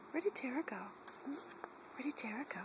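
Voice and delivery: whispered, teasing the dog; playful whisper. Real, not acted. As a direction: whisper it like a peekaboo game. Playful hide and seek with dog.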